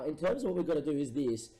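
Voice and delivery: ordinary talk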